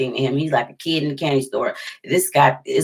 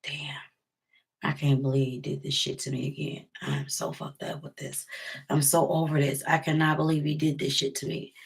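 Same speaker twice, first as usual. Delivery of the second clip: hushed, near-whispered despair; murmured to herself in the dark, stunned and hurt; quiet self-talk; 10 dB quieter than usual. Retold or imitated. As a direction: say it under your breath, alone at four in the morning. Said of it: "I was just like".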